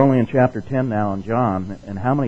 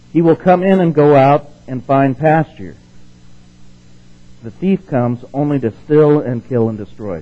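louder, slow Bible reading; scripture reading; 4 semitones higher, 8 dB louder than usual. Acted. Preacher reading John to the congregation, louder and slow. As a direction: proclaim the verse to the class.

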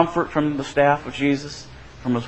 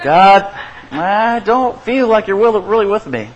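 pleading prayer; 6 semitones higher than usual. Acted. Voicing a doubting prayer.

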